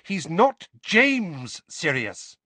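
high, very slow and pointed; pointed heated mother; slow pointed rebuke. A narrator's reading. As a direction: spell it out pointedly. Mrs Weasley.

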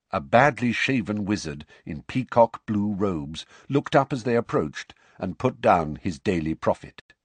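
ordinary delivery, nothing marked

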